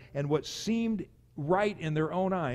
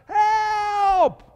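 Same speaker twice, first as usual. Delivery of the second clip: shouted cry for help; 12 dB louder than usual. Retold or imitated. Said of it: "I'm crying out".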